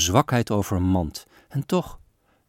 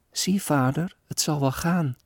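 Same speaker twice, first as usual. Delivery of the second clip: put-on child; 7 semitones higher than usual. Sick girl answers father.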